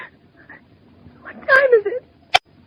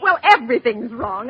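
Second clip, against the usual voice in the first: normal talking voice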